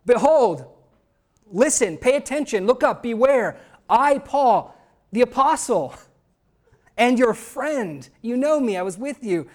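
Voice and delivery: high, loud urgent plea as Paul; Paul crying out to his readers, behold; voicing Paul. Apostle Paul calling out a loud, high warning to Galatians. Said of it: "Paul says this and adds".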